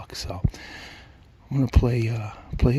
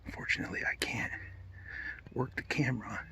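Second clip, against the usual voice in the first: hushed hunter's whisper; whisper, the bird is right there; wildlife watching whisper; 10 dB quieter than usual. Real, not acted. Turkey close, hunter hiding.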